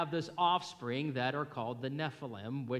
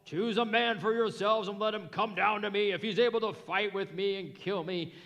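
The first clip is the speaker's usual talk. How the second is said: loud, higher taunting giant; Goliath taunting; 5 semitones higher, 3 dB louder than usual. Retold or imitated. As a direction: bellow a challenge across the battle lines. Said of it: "I don't know if that's his voice".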